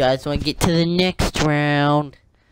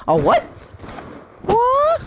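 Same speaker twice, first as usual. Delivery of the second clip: shocked squeak; slower than usual. Real, not acted. Surprised in game.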